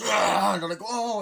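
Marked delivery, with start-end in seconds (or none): 0.0-0.5 loud, high mock roar; imitated ape roar. Ape busting in on them, loud mock roar. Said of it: "busts in and goes". 0.8-1.2 loud, high "oh" of shock; mock startled cry. Characters startled by an ape, loud mock cry.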